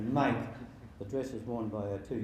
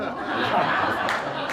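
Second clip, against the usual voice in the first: hearty laugh; 8 semitones higher, 8 dB louder than usual. Real, not acted. Laughs at own joke.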